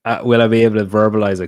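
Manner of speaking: ordinary delivery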